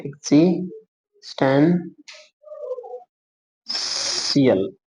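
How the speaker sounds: Existential stretched very slowly, every syllable drawn out. Shown as a demonstration. Teacher drawing the outline for students, very slow drawn-out word. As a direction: let existential hang slowly while your pen traces it.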